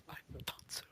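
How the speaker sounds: whispered to self. Real, not acted. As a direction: breathe it out under the breath. Whispered repeat.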